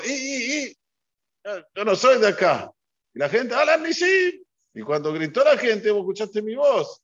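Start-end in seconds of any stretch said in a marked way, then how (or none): 0.0-2.7 high, stumbling y y y; flustered stammer. Retold or imitated. Rabbi leading prayer realises he is not from here, flustered stammer. 3.5-4.4 much higher, loud call of Alan Nisim; crowd calling out. Retold or imitated. Congregation crying out Alan Nisim to the leader, loud high call.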